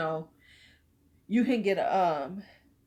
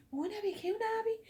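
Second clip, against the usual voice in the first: put-on high, soft little-girl voice; mock sweet voice; 6 dB quieter, faster than usual. Asking her big brother for cherry tattoos, in a high sweet voice. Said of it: "I was like".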